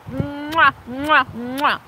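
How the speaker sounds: smacking air kisses; blown kisses. Real, not acted. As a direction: blow kisses to the chat. Blowing kisses to viewers.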